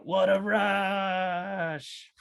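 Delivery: long, drawn-out exhilarated yell, high; whooping with the thrill, slow and high; thrilled cry. Acted. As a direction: ride the waterfall drop, stretching every word out. Acting thrilled rafter going over waterfall.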